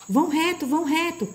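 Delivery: high-pitched guiding cry; urgent recommending call. A narrator's reading. The voice tells them to go straight, calling out. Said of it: "recomendou a voz".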